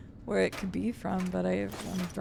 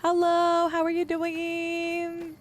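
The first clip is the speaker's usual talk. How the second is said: cutesy greeting; 9 semitones higher, much slower than usual. Real, not acted. Greeting chatter Voice in a high squeaky coo. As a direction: coo at a friend like a pet.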